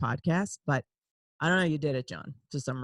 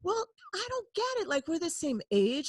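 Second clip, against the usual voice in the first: envious protest; 11 semitones higher, 3 dB quieter than usual. Retold or imitated. Acts out her envious thoughts.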